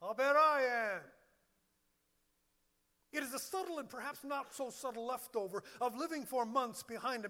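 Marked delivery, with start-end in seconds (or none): 0.0-1.0 loud, emphatic burst; loud admission. Acted. Pastor turning the charge on himself, loud admission. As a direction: belt it out as a mock confession.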